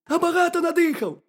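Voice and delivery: high, squeaky little hedgehog; very high, loud cartoon critter voice. A narrator's reading. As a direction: pipe it up as a tiny curious hedgehog. Hedgehog calls into the burrow.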